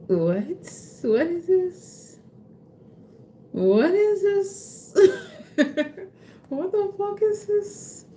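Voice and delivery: high, slow squeal of disbelief, half laughing; high-pitched mock disbelief with a laughing cry; stunned disbelief. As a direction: shriek it like you can't believe your luck. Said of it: "it's just gonna be so, like".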